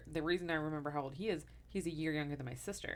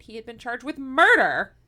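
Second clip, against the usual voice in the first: louder punch on the shocking twist; sudden louder reveal; dramatic loud reveal; 5 semitones higher, 15 dB louder than usual. Real, not acted. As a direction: land the murder charge hard. Dramatic reveal of murder charge.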